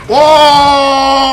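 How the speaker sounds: long, high, loud "whoa"; amazed shout. Real, not acted. Player amazed at a huge hit, amazed shout. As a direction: holler it high and hold it.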